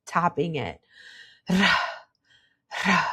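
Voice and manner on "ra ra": "Ra" rolled on a sigh; "ra" trilled with relaxed tongue tip. Shown as a demonstration. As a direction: breathe out "ra" with a loose tongue roll. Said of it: "relaxing your tongue so it rolls".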